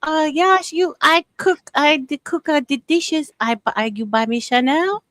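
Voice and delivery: hammed-up voice of a friend's wife; imitating his wife. Friend mimics how Josh's wife talks, mock broken English. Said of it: "She talk like this".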